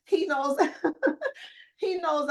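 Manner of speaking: high, giggly laugh running through the words; laughing with delight. Real, not acted. Laughs with joy while retelling.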